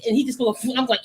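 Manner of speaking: ordinary talk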